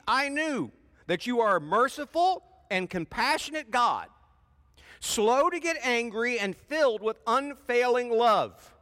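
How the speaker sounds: high, loud aggrieved whine; Jonah complaining. Acted. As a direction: rail at God like a sulking prophet. Jonah's angry complaint to God.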